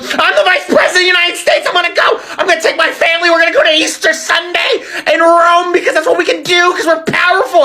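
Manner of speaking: сноб-зануда, высокий насмешливый голос; mocking whiny Vance voice.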